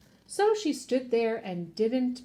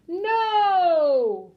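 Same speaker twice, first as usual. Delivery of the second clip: long, loud, high no; big drawn-out shout of refusal; group yell; 7 semitones higher, 8 dB louder than usual. A narrator's reading. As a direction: yell it out with full force, stretched. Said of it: "all yelled".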